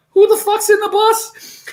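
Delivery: high-pitched who-the-hell shock; shocked outburst. Retold or imitated. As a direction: squeal it like you can't believe your eyes. Shocked at the unknown passenger.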